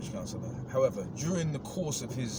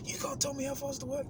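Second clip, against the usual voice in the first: mock coworker; 13 semitones higher than usual. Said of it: "You need to work".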